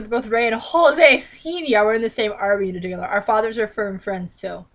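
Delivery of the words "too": ordinary talk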